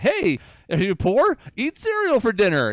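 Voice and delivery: high, loud and slow, falsely cheery; chirpy mock CEO. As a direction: sell it like a grinning ad man. Mocking CEO's cheerful message.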